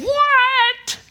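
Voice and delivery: What held high and loud; Paul's incredulous cry voiced by the preacher; outraged shout. Shown as a demonstration. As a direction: fling it out as an impossibility. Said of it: "When he said".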